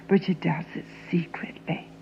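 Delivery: hushed whisper; a secretive whisper. Acted. Confiding about mother.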